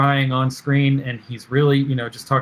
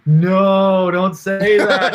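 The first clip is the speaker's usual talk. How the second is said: alarmed protest; 6 semitones higher, 6 dB louder, much slower than usual. Retold or imitated. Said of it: "And I was like".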